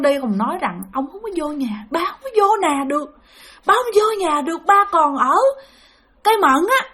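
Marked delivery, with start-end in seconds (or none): 1.9-6.9 high, pained spirit voice; plaintive farewell. Retold or imitated. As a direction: imitate a suffering elder lamenting to family. Spirit's parting lament, retold.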